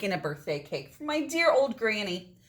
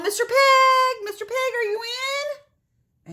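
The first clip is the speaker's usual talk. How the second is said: sweet calling out; 13 semitones higher, 5 dB louder than usual. Acted. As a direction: croon up at the door like a friend. Wolf calls to the pig.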